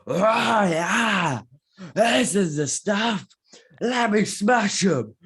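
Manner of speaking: high, loud, slow gruff soldier shout; Wrecker impression. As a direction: bellow it like a brawny clone trooper. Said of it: "Impression of the Week".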